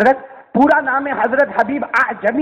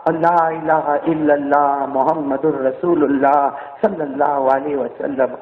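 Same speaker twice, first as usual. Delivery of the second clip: low, slow dying testimony of faith; measured, reverent kalima; solemn recitation; 5 semitones lower, slower than usual. Acted. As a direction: recite it hushed in pitch, drawn out.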